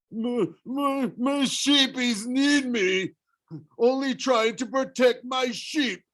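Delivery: high, slow tearful monster voice; a wounded giant pleading for his sheep; sobbing Cyclops. A narrator's reading. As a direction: Cyclops begging Percy to spare him, high and tearful. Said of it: "He began to sob".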